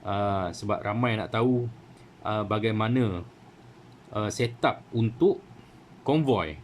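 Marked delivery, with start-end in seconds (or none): none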